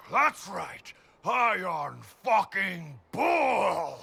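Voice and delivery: booming boast, drawn out; triumphant shout. Acted. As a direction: roar it out like a victory cry. Bragging over beaten demon.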